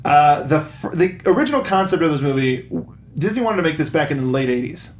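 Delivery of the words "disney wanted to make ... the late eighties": ordinary delivery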